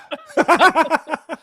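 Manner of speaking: high loud real laugh. Real, not acted. Co-host laughing at the host's British attempt. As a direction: burst out laughing at the accent. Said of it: "Yeah, I like it".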